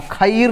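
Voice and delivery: "खीर" read as kha-eer, two vowels; wrong split reading. Shown as a demonstration. Teacher reading out a misspelling, "खीर" as kha-eer. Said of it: "गलत है".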